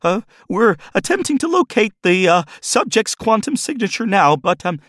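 very high, hesitant question; squeaky nervous assistant; timid technician. Acted. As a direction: ask warily, afraid to annoy. Technician excuses himself nervously.

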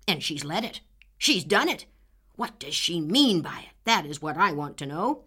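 put-on loud, furious old farmer shouting; angry old man. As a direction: roar at the girl, red-faced with rage. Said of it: "quite speechless with wrath".

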